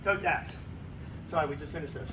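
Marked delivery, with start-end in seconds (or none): none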